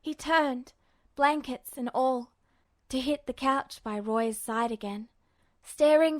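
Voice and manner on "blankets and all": usual speaking voice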